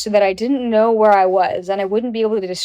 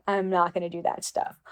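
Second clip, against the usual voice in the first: a polite but firm no, dropped softer; respectful softer refusal; 2 semitones lower, 7 dB quieter than usual. Retold or imitated. To the photographer, repeating the refusal: much softer and respectful.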